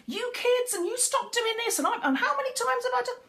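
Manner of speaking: shrill parent scolding, pitched very high; a parent losing it with the kids, voice way up; mock telling-off pitched far up; voice going up. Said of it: "your voice starts going up and up and up".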